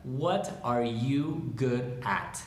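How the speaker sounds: word by word, slowly; slow dictation. Shown as a demonstration. Teacher modelling the question for learners, slow and separated. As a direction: space out every word of the question. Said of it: "Esa es la pregunta".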